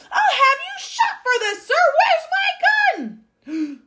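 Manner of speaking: high, loud, frantic shouts; furious shouting. A narrator's reading. Duke raging at bird stealing his cherries, furious shouting. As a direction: yell in outrage at the top of the voice. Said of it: "the Duke was shouting".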